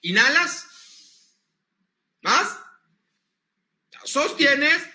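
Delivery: slow, spaced breathing instructions; guided breathing cues. Shown as a demonstration. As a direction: lead the breath, pausing long between commands. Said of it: "ahora hasta el seis".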